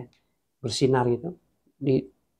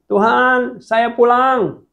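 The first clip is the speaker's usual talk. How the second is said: much higher and louder, stretched homecoming cry; joyful shout; 9 semitones higher, 6 dB louder, much slower than usual. Retold or imitated. Dead man arriving in heaven calls joyfully to God. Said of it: "Dia teriak gini".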